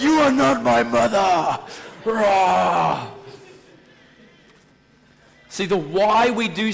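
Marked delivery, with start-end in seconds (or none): 0.0-1.6 mock angry retort. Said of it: "I'll be like". 2.0-3.1 mock angry growl. Mock growl after imagined retort.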